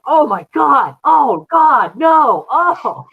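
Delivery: loud, high mock horror; mock frightened. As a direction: wail in hammed-up dismay at the sight. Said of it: "the Nick being afraid".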